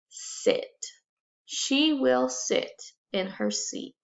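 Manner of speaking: ordinary talk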